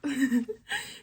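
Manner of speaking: short high laugh. Real, not acted. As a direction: burst out laughing at your own joke. Laughs at own monster line.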